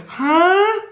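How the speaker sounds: high pleading upward lilt; every phrase climbs into a begging question; needy tonality. Shown as a demonstration. Coach mimicking a needy invitation, high and pleading. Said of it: "makes you seem needy".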